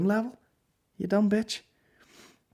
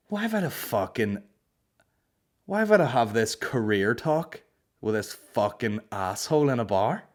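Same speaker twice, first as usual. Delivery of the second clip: usual speaking voice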